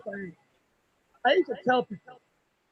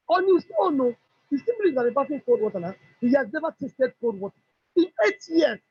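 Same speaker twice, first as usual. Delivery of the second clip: ordinary delivery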